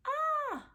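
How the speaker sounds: sudden high yelp of surprise; a sharp gasped "Ah!" as the ring slips away; startled cry. Acted. Girl losing her ring at the spring, sudden high startled cry.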